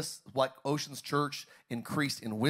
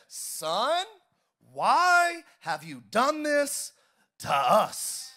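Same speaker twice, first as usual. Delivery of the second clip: anxious mother; 9 semitones higher, 6 dB louder, much slower than usual. Acted. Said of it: "And his mother said".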